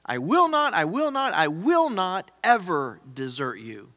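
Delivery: WILL punched high and loud, slowing into EVER desert you; powerful declaration. Acted. Preacher voicing God's promise to believers, powerfully emphatic. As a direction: thunder each WILL NOT, then land firmly. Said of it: "That's a pretty powerful statement".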